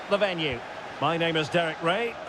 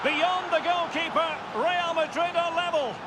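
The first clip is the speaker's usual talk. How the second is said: high, rising thrill as the equaliser goes in; excited goal call; 8 semitones higher than usual. Real, not acted. Commentator announcing the equaliser to viewers, excited high call. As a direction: ride the moment all the way to level.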